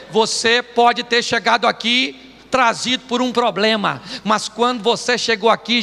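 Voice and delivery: ordinary talk, nothing marked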